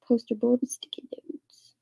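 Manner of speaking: soft unvoiced whisper, barely there; whispered aside. Real, not acted. Streamer reading a chat comment to herself, whispering. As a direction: breathe it to yourself like reading a note.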